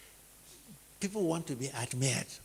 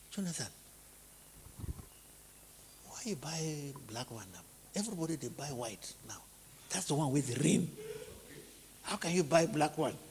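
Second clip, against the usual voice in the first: 1 hushed gossip; 6 dB quieter than usual. Retold or imitated. Gossip questions car colour.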